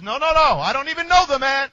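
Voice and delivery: loud, high, fast denial; Peter's panicked protest; vehement denial. Retold or imitated. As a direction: insist loudly and quickly. Peter denies Jesus again.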